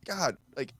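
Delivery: exasperated rant. Retold or imitated. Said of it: "It's like".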